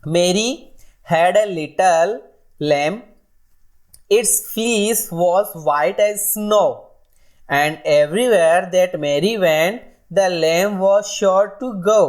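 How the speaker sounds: very slow, sing-song nursery rhyme recital; slow rhyme reading. Shown as a demonstration. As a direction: chant it out line by line for children. Reads nursery rhyme to class.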